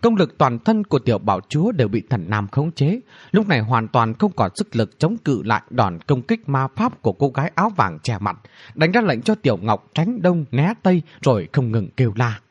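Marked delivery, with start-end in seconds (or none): none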